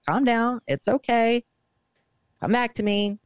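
mildly acted calming plea; retold soothing. Wife to confused husband after seizure, retold high-pitched soothing.

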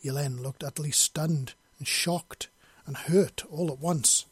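ordinary talk, nothing marked

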